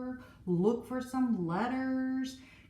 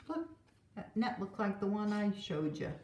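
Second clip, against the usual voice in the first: ordinary talk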